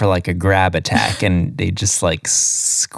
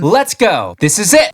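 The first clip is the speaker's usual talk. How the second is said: excited anime fan; 8 semitones higher than usual. Pretend. Mock fighter battle cries.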